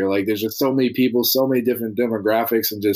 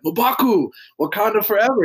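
high-pitched, playful call-out; hailing a friend; 11 semitones higher than usual. Retold or imitated. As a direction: imitate fans hailing their movie hero. Friends hailing their look-alike teammate.

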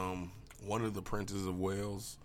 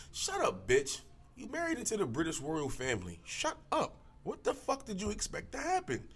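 high mock telling-off; 11 semitones higher than usual. Telling off Meghan Markle.

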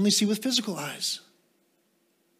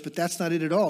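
ordinary talk

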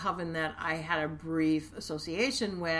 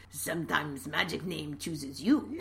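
lightly put-on Russian accent; a hammy stab at a Russian accent; bad Russian accent; 2 semitones lower than usual. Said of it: "Bad Russian accent".

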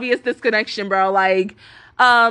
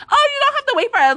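high, quick catty imitation; mock petty remark; 13 semitones higher, faster than usual. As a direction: mimic a petty rival, pitched way up. Mimicking petty rival's remark.

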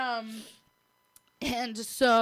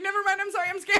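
higher, hammed-up timid plea; backing out after asking to be cuffed, mock scared; 8 semitones higher, faster than usual. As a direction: back out in pretend panic. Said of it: "And then be like".

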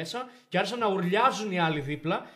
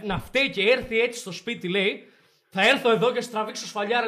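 louder, higher angry demand; angry father; 4 semitones higher, 6 dB louder than usual. Retold or imitated. Father bursting in on the principal, angry demand. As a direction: storm in and confront him.

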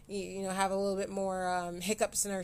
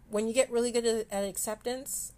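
usual speaking voice

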